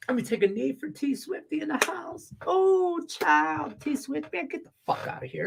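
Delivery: high, campy mock diva; mocking sassy voice. Mocking [name] as [name], high campy voice. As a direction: sneer it like a sassy fan. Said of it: "Like your name was [name]".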